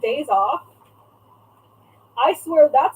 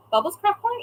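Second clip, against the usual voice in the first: puzzled question; 8 semitones higher, faster than usual. Real, not acted. Reading the chat, puzzled and much higher. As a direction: pitch jumps higher on Corner, questioning.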